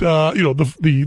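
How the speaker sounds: normal talking voice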